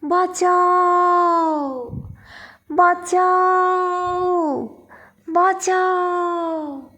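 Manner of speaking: long drawn-out cry for help, high and loud; screamed plea. A narrator's reading. Widowed woman fleeing three attackers, screaming for help. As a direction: cry out for rescue, stretching each call. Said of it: "চিৎকার করছে".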